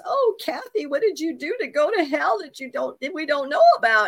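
pry at her like a suspicious mother; imitating accusing mother. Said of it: "my mother says".

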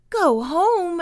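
high, loud exclamation of disbelief; exclaimed. A narrator's reading. Young beaver objecting to his brother's wish to leave, exclaiming. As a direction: throw it back at your brother, pitched high. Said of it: "exclaimed Noodle".

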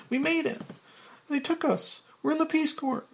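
put-on very high young woman's voice; excited young Claire. Young Claire waving a letter, high excited voice. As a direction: play her high and thrilled.

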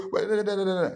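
high, loud repeated no; mock heated denial. Pastor acting a hot-tempered member, high insistent denial.

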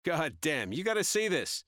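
high, loud calling out. Acted. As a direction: call out to the other room. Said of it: "Even louder, he said".

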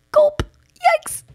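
squeaky high mock gulp and yikes; mock panic. Nervous speaker seeing a room of faces, squeaky mock panic. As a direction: yelp it like a scared cartoon.

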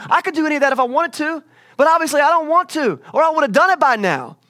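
high, loud mock rant; acted, shouted exasperation; exasperated rant. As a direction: rail at them as a fed-up God. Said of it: "He's like".

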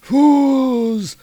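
high, strained monster outburst; drawn-out grand cry, climbing very high; Mangog. Acted. Monster rebuking giants who block him, high theatrical roar. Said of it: "the Mangog approaches them".

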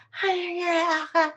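high, awkward, tongue-tied hi; starstruck fan. Retold or imitated. Speaker meeting Lily Tomlin as a fan, awkward. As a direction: meet your idol and freeze up.

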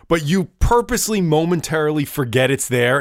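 ordinary delivery

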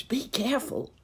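high stage whisper; whispered warning. A narrator's reading. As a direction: warn him in an audible whisper. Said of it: "whispered Uncle Wiggily to the horse".

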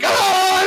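high mock scream of a panicking soldier; hammed-up scared yelp; mock freak-out yell. Mocking a soldier who panics and fires, mock scream. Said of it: "one of the guy freaks out".

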